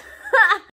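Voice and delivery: sudden high laugh out loud; real laugh at herself; loud burst of laughter. Real, not acted.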